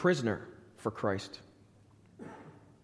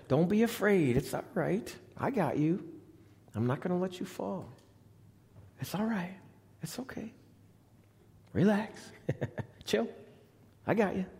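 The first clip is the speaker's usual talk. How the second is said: shepherd's reassurance; 7 semitones higher than usual. Retold or imitated. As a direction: soothe a frightened friend gently. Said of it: "That's a shepherd speaking".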